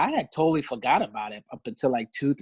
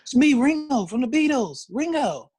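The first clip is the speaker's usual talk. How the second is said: very high imitated English accent; 11 semitones higher, 3 dB louder than usual. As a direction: insist on who you are in a high mock English voice. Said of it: "It was like an English accent".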